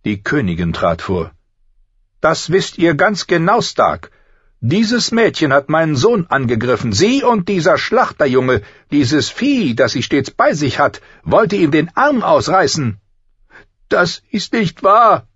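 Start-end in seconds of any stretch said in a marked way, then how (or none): 2.2-13.0 high, loud, venomous queen; evil queen. Acted. The queen accuses Arya. 13.9-15.3 put-on high, loud, slow child protest; loud protesting girl. A narrator's reading. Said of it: "widersprach Arya lauthals".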